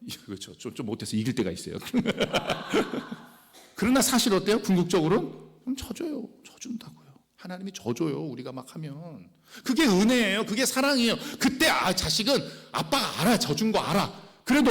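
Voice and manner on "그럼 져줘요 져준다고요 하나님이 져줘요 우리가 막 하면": confiding whisper. Real, not acted. Secret of the father's love.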